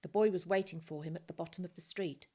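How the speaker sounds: ordinary delivery, nothing marked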